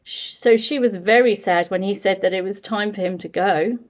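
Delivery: ordinary delivery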